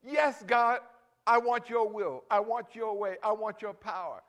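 high, loud cry of praise; loud, fervent shouting toward heaven. Shown as a demonstration. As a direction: cry out to God with everything. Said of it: "You can scream".

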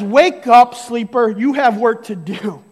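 booming call to wake up; voice of God calling. Acted. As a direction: thunder the summons. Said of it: "galaxy forming God of all things says".

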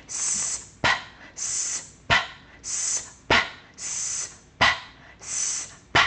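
long hiss then a popped p; hissing s, then a quick lip pop; alternating soft s and popped p; s p drill. Shown as a demonstration. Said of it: "let's practice that ten times".

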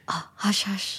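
breathy whispered shushing; whispered hush. Retold or imitated. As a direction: hush them urgently under your breath.

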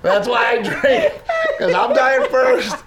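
hammed-up mock despair; laughing joke. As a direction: crack the dark joke laughing. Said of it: "I go".

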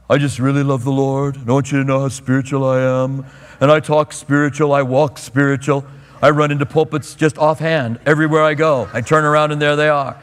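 hammed-up pious churchgoer; mock super-spiritual voice. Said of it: "he talked in a super spiritual voice".

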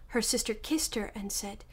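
usual speaking voice

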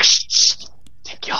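hushed shushing whisper. Retold or imitated.

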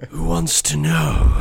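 slow, loud, high hardboiled growl; speakeasy tough guy. Pretend. Jim playing a speakeasy gangster to the stranger, hardboiled drawl. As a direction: drawl it like a gangster after a drag on a cigarette. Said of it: "that whole speakeasy thing".

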